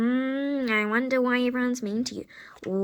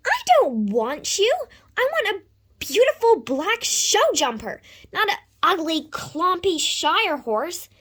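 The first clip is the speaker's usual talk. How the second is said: loud, bratty complaint; high, petulant outburst; whiny tantrum; 5 semitones higher, 3 dB louder than usual. Acted. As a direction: stamp your feet, spoilt and loud. Buyer tantrum on seeing horse.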